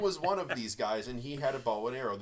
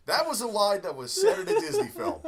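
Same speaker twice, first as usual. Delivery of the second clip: high, loud, through laughter; laughing; 13 semitones higher, 9 dB louder than usual. Real, not acted. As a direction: laugh it out in disbelief. Laughing disbelief.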